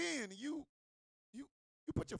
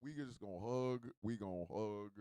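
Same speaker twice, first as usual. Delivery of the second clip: much lower, drained voice; mock deflated; 13 semitones lower, 3 dB quieter than usual. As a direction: sink into deflated resignation, playing it up. Acts out suddenly giving up mid-act.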